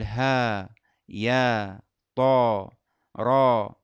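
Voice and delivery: ها يا طا را each ending on a plain long vowel, no hamza; letters without hamza. Shown as a demonstration. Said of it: "نقول".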